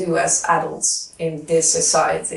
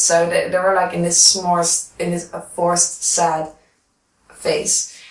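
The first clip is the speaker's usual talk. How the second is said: ordinary delivery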